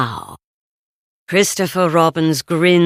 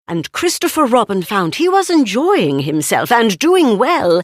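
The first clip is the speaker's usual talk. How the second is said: ordinary delivery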